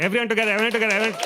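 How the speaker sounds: loud, high rallying call; calling out to crowd. Real, not acted. Host hyping up the audience with a loud call. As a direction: rev the crowd up for the next act.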